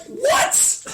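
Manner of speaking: a high, stunned "What?"; shocked what. Retold or imitated. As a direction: squeak it out in total disbelief. Shocked by the dealer's reply.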